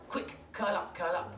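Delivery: ordinary delivery, nothing marked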